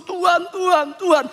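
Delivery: high-pitched mock cry to God; imitated calling out. Preacher voices Israelites calling on God, imitated calling out. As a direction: call out repeatedly, without real volume. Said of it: "Mereka berseru".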